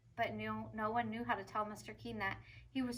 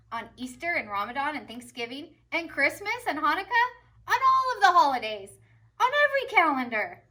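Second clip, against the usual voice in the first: booming high proclamation, building; rousing head teacher speech; proud principal; 3 semitones higher, 9 dB louder than usual. A narrator's reading. Principal to students and teachers, grand and rising. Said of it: "and said".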